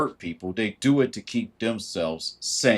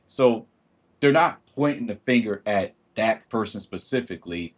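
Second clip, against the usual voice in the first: usual speaking voice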